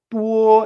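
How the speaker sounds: TUO held high, level and loud; first tone. Shown as a demonstration. Teacher isolates the first syllable, loud level tone. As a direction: sustain "Tuo" on one strong note. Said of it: "combinándolas con el primero".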